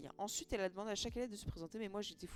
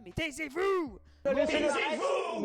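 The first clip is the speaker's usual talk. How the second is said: high, very loud imitation of a yelling teacher; 7 semitones higher, 11 dB louder, much slower than usual. French teacher hushing a noisy class, imitated yell. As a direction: bellow it, stretching the last word right out. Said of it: "et la secouait et hurlait".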